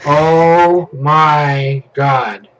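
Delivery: a long stunned groan of oh my god; drawn-out oh my god. Real, not acted. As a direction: stretch it out in shock. Townsfolk suddenly attack him.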